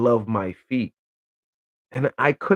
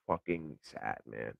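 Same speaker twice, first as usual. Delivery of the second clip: slow, drawn-out pity, like shaking your head; mock pitying sigh; 13 semitones lower, 17 dB quieter, slower than usual. To the listeners, slow mock-sad pity at the lonely men. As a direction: drag it out as if it's truly a shame. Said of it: "sometimes it's like".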